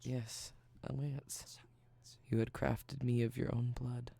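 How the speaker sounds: sultry close-mic voice. Acted. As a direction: lean into the mic, intimate. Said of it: "my sultry speaking voice".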